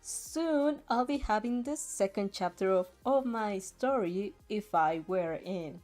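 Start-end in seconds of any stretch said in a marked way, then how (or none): none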